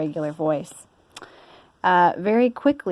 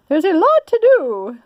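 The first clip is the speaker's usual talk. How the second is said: high, louder fussy lady voice; Missus Rogers; 11 semitones higher, 7 dB louder than usual. Acted. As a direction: bustle like a busy mistress. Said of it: "said Missus Rogers".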